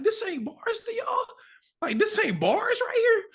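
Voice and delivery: way up high, disbelieving squeal; can't believe nobody else hears it, voice shooting way up; incredulous. Retold or imitated. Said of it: "I'm looking around like".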